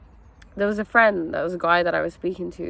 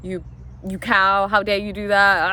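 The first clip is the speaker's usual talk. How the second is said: blaming. Acted. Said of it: "you're not blaming".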